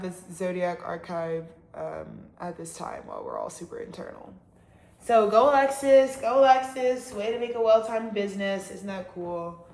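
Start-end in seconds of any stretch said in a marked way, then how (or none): 5.0-6.2 loud, bright cheer for herself; loud self-cheer, higher pitch. Real, not acted. 6.2-7.1 loud self-cheer, a little less high; second cheer, still loud; cheering herself on. Real, not acted.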